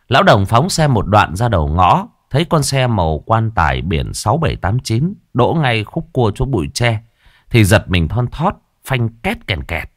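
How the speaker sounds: ordinary delivery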